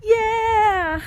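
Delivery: high happy shout; excited cheer. Real, not acted. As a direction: cheer with joy. Celebrating at summit.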